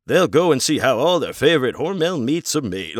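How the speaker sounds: old film narrator, voiced high and bright; hammed-up vintage voiceover; mock narrator. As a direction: ham up a cheesy training-film narrator. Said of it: "narration voiceover is like".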